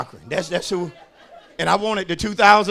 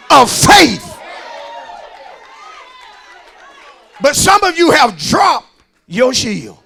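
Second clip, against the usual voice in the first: loud, emphatic sermon delivery; full-force preaching; 6 semitones higher, 10 dB louder than usual. Acted. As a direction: drive each phrase home to the congregation.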